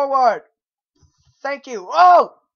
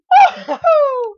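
real giddy laugh; high relieved laugh; 13 semitones higher, 5 dB louder than usual. Real, not acted. Relieved after success.

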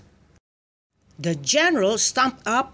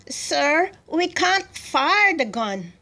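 higher-pitched, slow report to a superior; worried subordinate; 8 semitones higher, slower than usual. A narrator's reading. Gunner telling the general of a problem, apologetic. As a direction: break bad news to your commander in a raised voice.

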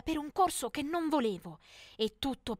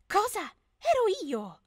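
shocked surprise; 8 semitones higher, 4 dB louder, slower than usual. Acted. Sees herself in viral video.

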